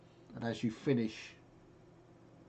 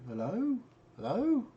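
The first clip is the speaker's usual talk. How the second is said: questioning hello, pitched up at the end; acted calling out; 12 semitones higher, 5 dB louder than usual. Game master voicing the player's call in his head, high questioning.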